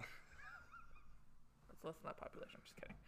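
breathy whisper, barely voiced; whispered aside. Real, not acted. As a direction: whisper the joke to yourself. Dark joke, then taking it back.

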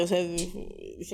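ordinary talk, nothing marked

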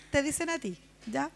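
ordinary delivery

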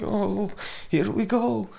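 high, wavering reluctance; mock dread. Bold people as death is coming, mock dread. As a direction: voice someone losing their nerve.

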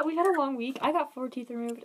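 ordinary talk

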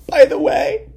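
very high put-on squeak; comic high-pitched interjection; squeaky aside. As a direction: jump way up in pitch. Sudden squeaky aside after laugh.

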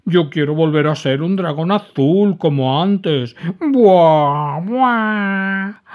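loud, high, slow protesting sob; inconsolable crying. A narrator's reading. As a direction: refuse through tears. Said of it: "seguía llorando desconsolado".

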